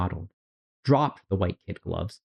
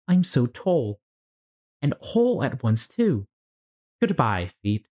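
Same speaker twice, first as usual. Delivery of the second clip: put-on lightly raised girlish Alice voice; Alice's voice; 4 semitones higher than usual. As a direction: read Alice a bit higher, amazed. Alice voiced in read story.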